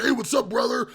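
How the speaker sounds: high, loud wrestler bark; Macho Man impression. Cheery sober house owner on the phone, wrestler-style loud greeting. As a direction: hype it up like a pro wrestler. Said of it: "he sounded like the Macho Man".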